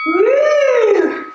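high, loud cheer; excited whoop; cheering woo. Real, not acted. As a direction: whoop to pump up the class. Cheering on the class.

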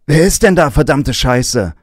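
wütend gebrüllt; furious shout. Acted. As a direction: bellow the curse at the caller. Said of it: "fragt sein Vater".

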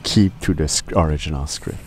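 ordinary talk, nothing marked

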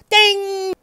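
high sung ding imitating a game-show bell. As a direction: chime it like the answer board. Correct-answer bell.

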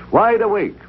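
ordinary delivery

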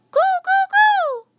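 high, loud hooting monkey calls; monkey hoots. A narrator's reading. Langurs hooting a warning to the children, high and loud. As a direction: hoot it out like a frightened langur.

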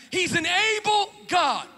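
"able" slow, high and shouted; shouted stress on able. Shown as a demonstration. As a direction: hammer "able" to rile the devil. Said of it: "Let me say it one more time to make the devil mad".

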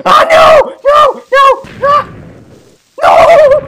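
very high, loud shrieks; repeated piercing yells; screamed protest. Real, not acted. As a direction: scream each word in alarm. Dies again to creepers.

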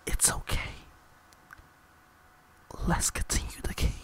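hushed breathy ASMR murmur; soft ASMR aside about the wind; ASMR whisper. Pretend. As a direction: whisper into the mic like an ASMR video. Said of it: "I'm gonna do some A S M R real quick".

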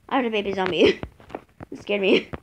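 usual speaking voice

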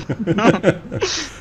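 high, rising laugh. Real, not acted. Agreeing with friend through high laugh.

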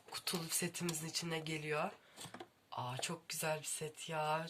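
hushed, breathy admiration; awed whisper. Real, not acted. Booktuber whispering over a boxed book set. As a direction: whisper it in awe.

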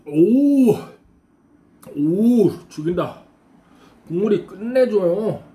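slurping and exhaling after sips; speaking between sips of soup. Real, not acted. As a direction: mouth wet with hot broth. Seen 4: Man spoons broth from pot into mouth throughout, lips pursed.

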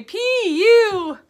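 pinched nose, nasal P U; plugged nose. Shown as a demonstration. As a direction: say it holding your nose. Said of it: "Can you guys all plug your nose? Say".

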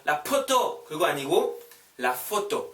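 first "photo" with a p, then with an f; wrong p then right f. Shown as a demonstration. Teacher showing the wrong then the right sound. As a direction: contrast a p in "photo" with the correct f. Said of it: "P 발음 아니에요".

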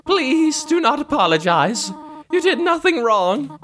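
proper, motherly and hesitant; tender motherly game character. Acted. Voices a tearful game mother.

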